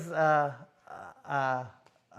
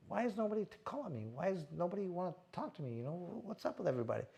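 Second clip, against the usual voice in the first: quiet wondering; 11 dB quieter than usual. Retold or imitated. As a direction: murmur it like a private worry. Retells his own hurt thoughts.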